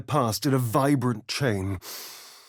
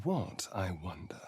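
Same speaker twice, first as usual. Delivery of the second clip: breathy unvoiced whisper, much softer; 6 semitones lower, 12 dB quieter than usual. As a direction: whisper the question to yourself. Musing whispered aside.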